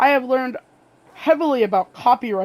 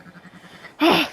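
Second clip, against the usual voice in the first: emotional sigh; 7 semitones higher, 7 dB louder than usual. Real, not acted. Moved by a sad tribute message.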